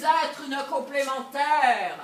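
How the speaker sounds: loud, indignant declaration; shouting. Acted. Wife refusing to be a complement any longer, shouting. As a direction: raise your voice in protest. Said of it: "Ne criez pas".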